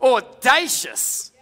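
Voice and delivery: Audacious, slow and high, every syllable stretched; drawn out audacious. Shown as a demonstration. Pastor relishing the woman's nerve, slow drawn out. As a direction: savor the word Audacious slowly.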